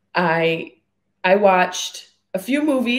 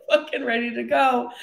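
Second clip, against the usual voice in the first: higher, said through a laugh; giggly, raised "ready to go"; laughing claim; 5 semitones higher than usual. Real, not acted. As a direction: push "ready to go" out while giggling. Laughing through mock claim, then restated.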